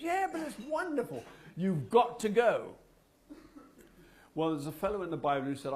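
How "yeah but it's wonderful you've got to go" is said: much higher, bursting with delight; excited. Retold or imitated. The wife urging her husband to come to church, excited. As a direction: gush as if you can't contain it.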